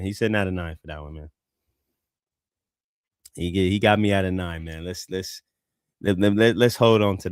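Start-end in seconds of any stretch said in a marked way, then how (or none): 0.0-1.3 fast, lower throwaway repeat; quick aside. Real, not acted. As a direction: toss it off quickly under the breath.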